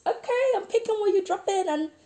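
high, loud, flirty teasing; playful teasing. Real, not acted. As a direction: tease her like a flirt. Teasing flirt toward friend.

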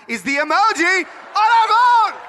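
loud, high, stretched-out exclamation; excited shout. Real, not acted. Son revealing his dad is the emoji, shouting excitedly. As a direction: shout it slowly, climbing high.

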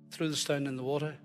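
normal talking voice

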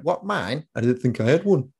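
imitated Cumbrian accent with swooping pitch. Cumbrian lady answering his dad's small talk, imitated Cumbrian accent. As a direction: put on a weird, lilting Cumbrian accent. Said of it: "They've got a weird accent".

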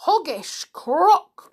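high, loud, halting indignation; aghast monkey crying out; outraged cry. Acted. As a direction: punch each insult out separately. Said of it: "cried Mugglewump".

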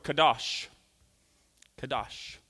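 "kadosh" with a long open second syllable; correct Hebrew pronunciation. Shown as a demonstration. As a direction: say "kadosh" as kah-DAHSH. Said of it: "Not skadoosh".

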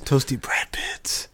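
soft breathy whisper; whispered repeat. Real, not acted. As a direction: whisper it back to yourself. Repeating the British phrase.

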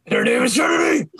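high, loud laughing shout; furious shout. Acted. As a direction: yell the name out. Shouted climax of threat.

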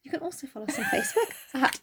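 breathy whispered plug; whispering. Real, not acted. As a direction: whisper it with a laugh.

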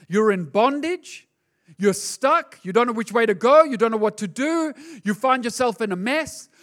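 ordinary talk

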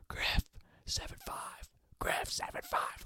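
urgent whispered call, slow; whisper yelling. Retold or imitated. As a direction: call names without being heard. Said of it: "whisper yelling".

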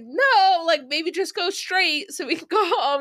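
high, laughing pleading voice; whiny mock plea. Reviewer imitates [name] begging his friend to go home, high and laughing. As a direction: beg him half laughing. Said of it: "And [name]'s like".

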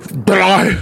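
first stab at the man's voice; mock the old line-cutter; older man's voice. Line-cutter caught by a look, mock older man's voice. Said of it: "I gotta do his voice right".

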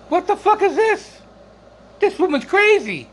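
high shocked what the fuck; shocked disbelief. Retold or imitated. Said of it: "And I'm like".

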